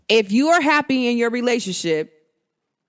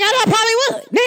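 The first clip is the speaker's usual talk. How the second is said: high, louder, teasing retort; sassy comeback; 13 semitones higher, 4 dB louder than usual. Retold or imitated. Answering the jealous man, sassy and high. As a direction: fire it back with sass. Her own comeback to him.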